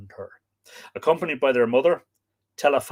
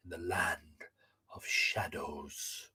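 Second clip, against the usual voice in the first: whisper; 7 semitones lower, 12 dB quieter, slower than usual. Acted. Land of shadows.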